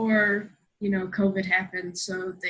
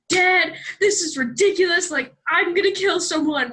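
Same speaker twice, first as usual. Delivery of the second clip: high, louder outraged rant to dad; mock screaming; 8 semitones higher, 5 dB louder than usual. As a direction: re-enact a worked-up outburst, pitched high.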